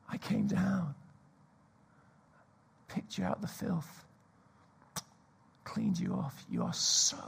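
soft, slow, breaking into whisper; tender whisper. Acted. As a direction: speak to each one like a secret of love. Voicing Jesus to the congregation.

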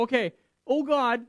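loud, high cry of surrender; desperate cry to God. Retold or imitated. Sailors turning to Jonah's God, loud and high. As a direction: sailors giving in, crying to God. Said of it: "They're like".

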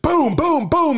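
high-pitched booms; storyteller's gunfire noises; imitated gunshots.

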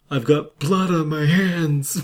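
a touch of hammed-up drama, slow; mock dramatic line. Host mocking a clichéd movie line, slow hammed-up drama. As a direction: deliver it like a cheesy movie line. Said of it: "you can use the nice".

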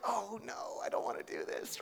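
softly voiced oh no, trailing to a breath; a scared inner groan; reluctant dread. Speaker's fearful inner voice when God calls, soft dread. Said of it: "I'm like".

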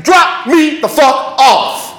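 slow shout, every word separate; forceful demand. Retold or imitated. Kid with gun demands out of the car, forceful shout.

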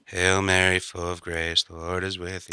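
low, softly intoned prayer; prayer recitation. Pretend.

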